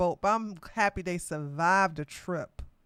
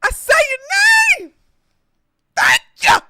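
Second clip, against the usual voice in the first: mock church praise; 13 dB louder than usual. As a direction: shout praise like a revival worshipper.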